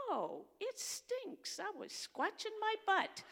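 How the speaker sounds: put-on high, slow, loud child's voice; little boy voice. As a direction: play the five-year-old owning up. Retold grandson's answer.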